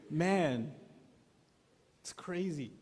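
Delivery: ordinary talk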